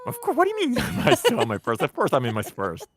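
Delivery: high, loud, fast disbelief; can't believe what they asked; incredulous. Real, not acted. Incredulous teasing question.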